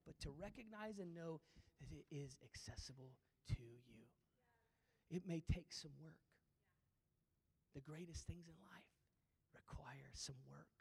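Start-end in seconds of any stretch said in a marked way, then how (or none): 1.8-6.1 soft, hushed promise; whispered assurance. Real, not acted. Pastor whispering reassurance to struggling believers. 7.7-10.7 hushed, slowing at the end; whispered encouragement. Real, not acted. Pastor softly encouraging the congregation.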